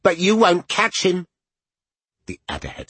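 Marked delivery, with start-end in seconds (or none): 0.0-1.2 put-on defiant child voice. Jacopo voice.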